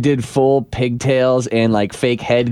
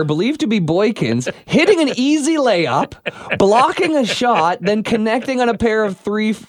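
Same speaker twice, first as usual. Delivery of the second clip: news read; 8 semitones higher than usual. Acted. Reads news description aloud.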